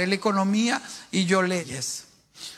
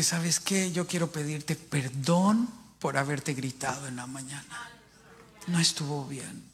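sincere apology; 7 semitones lower, 4 dB quieter than usual. Retold or imitated. Apologizing to his older brother.

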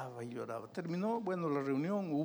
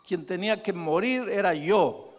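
anguished cry; 6 semitones higher, 10 dB louder, slower than usual. Retold or imitated. Retold man's anguished confession.

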